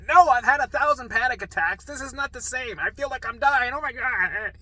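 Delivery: high, loud frantic pleading; panicked protest. Retold or imitated. Patient insisting to nurse he is dying, panicked protest. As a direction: wail it in acted panic.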